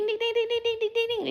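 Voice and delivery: high rapid ding ding; brain dinging. Retold or imitated. Describing her racing brain with high dings. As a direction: ring out dings like a busy brain. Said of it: "my brain is like ding".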